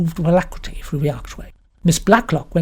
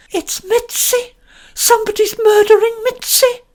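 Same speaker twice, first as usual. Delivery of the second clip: called out; 5 dB louder than usual. A narrator's reading. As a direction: call out in panic. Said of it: "called out".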